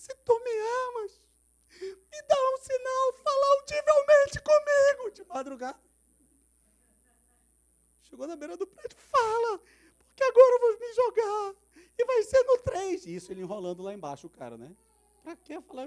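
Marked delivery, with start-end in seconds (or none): 0.0-5.1 very high, loud, slowed demand; desperate shouted plea. Retold or imitated. Man demands sign from God. 9.0-13.1 very high, loud, slowed demand; desperate shouted plea. Retold or imitated. Man on roof edge.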